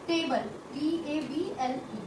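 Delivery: टेबल said slowly, split into टे-बल, then spelled out; slow, soft, with a slight break in टेबल; syllable break demo. Shown as a demonstration. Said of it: "यहाँ पर आप देखेंगे एक स्लाइड सा break है". (धीमे से टेबल, अक्षरों को तोड़कर)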